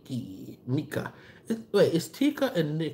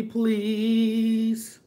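normal talking voice

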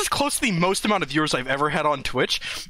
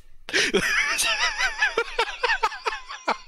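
shrieking high laugh. Real, not acted. Laughs at the category listing.

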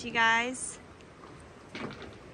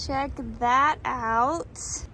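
ordinary talk, nothing marked

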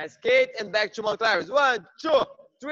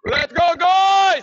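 loud, high rallying cry; hype shout; 6 semitones higher, 6 dB louder, slower than usual. Real, not acted. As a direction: pump the room up. Rallying the class.

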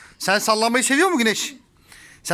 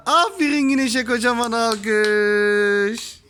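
slow cheer with a long held last word; praising cheer; 3 semitones higher, slower than usual. Real, not acted. Praising Güneş for finding her nose, drawn-out cheer. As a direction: announce the applause, stretching the final word. Cheering the baby for right answer.

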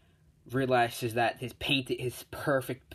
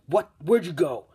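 loud, high startled questions; acted astonished outburst; baffled shout; 6 semitones higher, 8 dB louder than usual. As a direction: call out after someone who just vanished. Endeavor loses sight of Jack.

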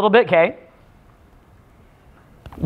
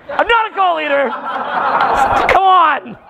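demo outburst; 7 semitones higher than usual. Shown as a demonstration. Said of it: "Okay, I'm getting mad".

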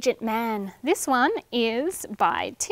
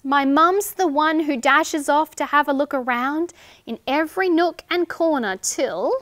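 put-on lively sing-song reading to children; rhyme read aloud; 2 semitones higher, 7 dB louder than usual. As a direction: read the rhyme brightly to little ones. Reading a rhyming picture book.

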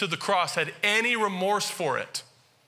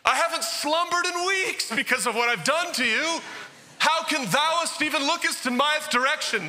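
mock groveling apology; 11 semitones higher than usual. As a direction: ham it up like a weeping Shakespeare penitent begging forgiveness. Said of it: "They're like".

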